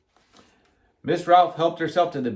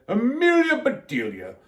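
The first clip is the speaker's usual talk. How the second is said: raised, scolding voice; angry; 6 semitones higher than usual. A narrator's reading. Mister Rogers confronts Amelia Bedelia, angrily. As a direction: demand an answer, pitch up. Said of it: "Mister Rogers was very angry too".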